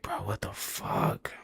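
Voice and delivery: slow, hushed whisper; whispered what the fuck. Retold or imitated. Crying in the ambulance, stunned whisper. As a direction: breathe it out in shock. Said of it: "'Cause I'm like".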